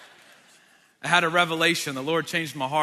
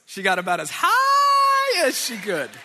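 "high" stretched very long, high and loud; comic stress on high; 10 semitones higher, 7 dB louder, slower than usual. Real, not acted. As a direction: shoot up on "high" and hold it, then finish. Comic emphasis on the decoration height.

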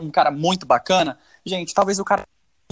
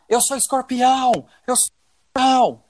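imitating a man yelling mid-act; retold shout; 7 semitones higher than usual. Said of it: "ele gritava".

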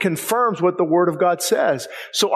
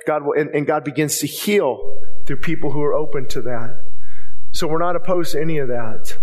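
preaching at full force, much louder; fiery preaching; 3 semitones lower, 8 dB louder than usual. Acted. Pastor booming at his congregation, loud preaching. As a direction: drive it home to the pews, loud.

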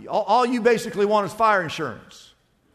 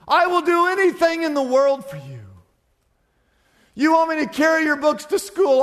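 high, fervent pledges of service; devoted rescued man; 7 semitones higher than usual. Acted. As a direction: gush eager promises to your rescuer, offer after offer.

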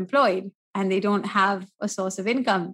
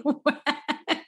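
laughing; 5 semitones higher, 4 dB quieter than usual. Real, not acted. Laughing about sweating.

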